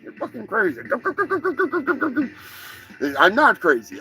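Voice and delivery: ordinary talk, nothing marked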